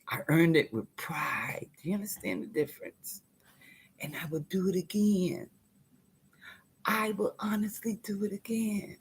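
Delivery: hushed, intense whisper; quiet defiant whisper. Real, not acted. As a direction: lean in and whisper it. Intimate whispered resolve after loud boast.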